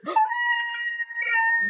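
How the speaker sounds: very high, thin gasp; starstruck squeal. Acted. Fan recognized by their idol, high gasping squeal. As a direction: squeal breathlessly at being noticed. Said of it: "I make that noise".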